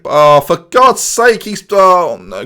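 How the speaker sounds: slow, loud exasperated groan of the words; frustrated. Real, not acted. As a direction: let the frustration drag out each word.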